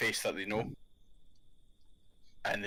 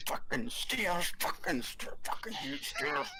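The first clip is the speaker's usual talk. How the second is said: grumbling dwarf; 5 semitones higher, slower than usual. Retold or imitated. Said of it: "you just hear him walking up and going".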